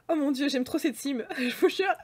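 ordinary talk

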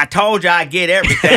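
proud boast. Retold or imitated. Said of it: "is very proudly".

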